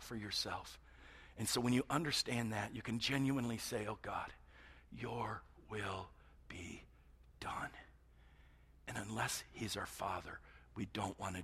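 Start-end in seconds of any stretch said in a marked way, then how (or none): 3.9-7.7 very slow, soft whisper; a drawn-out whispered surrender to God; slow whispered prayer. Acted. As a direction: hush it out as a heartfelt prayer.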